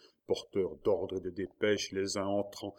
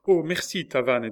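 very high, loud burst of gratitude; relieved thanks; 7 semitones higher, 10 dB louder than usual. A narrator's reading. Marguerite thanks Tavannes, high and breathless with relief. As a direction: thank him in a rush. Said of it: "dit Marguerite".

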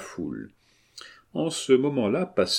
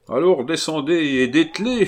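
loud, high command; raised-voice order; 8 semitones higher, 6 dB louder than usual. A narrator's reading. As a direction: order the driver down, voice lifted. Carmagnole orders the carter down.

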